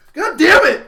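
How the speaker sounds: high, loud cursing yell; frustrated outburst. Retold or imitated. Cursing loudly at the roommate's luck. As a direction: explode with frustration.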